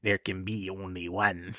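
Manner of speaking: low, soft, slow movie-hero imitation; odd actor voice. Co-host imitating the hero at the film's end, low slow voice. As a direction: intone it slowly, quiet and deep. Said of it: "he kinda talks like that at the end".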